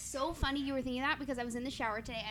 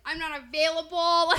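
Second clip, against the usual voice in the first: mock louder retort; 3 semitones higher, 10 dB louder than usual. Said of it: "I was like".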